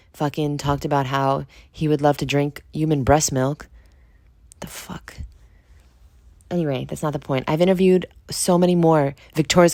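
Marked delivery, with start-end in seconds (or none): 4.6-5.1 disbelieving whisper under the breath; a stunned, whispered "the fuck"; whispered aside. Real, not acted. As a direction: mutter it in disgusted disbelief. Disgusted aside about breast milk.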